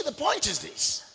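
usual speaking voice

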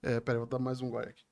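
choking on a drink, strained and soft. Real, not acted. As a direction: croak it out after choking on water. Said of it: "acho que eu engasguei com".

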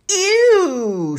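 loud, high, drawn-out cry of disgust; disgusted sniff. A narrator's reading. Paul hears broccoli is going on pizza, disgusted. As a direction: recoil loudly from a horrid food. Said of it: "sniffed Paul".

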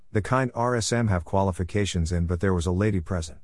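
plain reading voice

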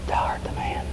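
hushed, breathy whisper; the prophet whispers his rebuke to the king; whispered accusation. Acted. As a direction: say it like a quiet verdict.

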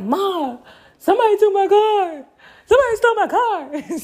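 high, loud panicked wail to mom; frantic, near-tearful pleading; hysterical. Retold or imitated. On the phone to mom after the car vanished, hysterical and high. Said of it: "call hysterical".

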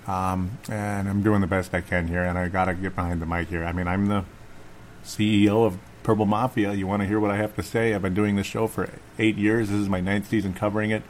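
usual speaking voice

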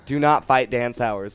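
loud, emphatic retold warning; urgent warning. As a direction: warn a friend off, forcefully. Warns friend off a fight.

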